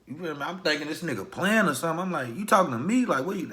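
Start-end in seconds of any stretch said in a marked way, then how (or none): 2.4-3.5 retold angry challenge. Confronting OG on the porch.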